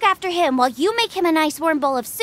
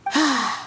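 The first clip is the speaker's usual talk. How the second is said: exasperated sigh; 9 semitones lower than usual. Acted. Weary sigh as friend rushes off.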